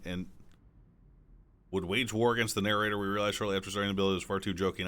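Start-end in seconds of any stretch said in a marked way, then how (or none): none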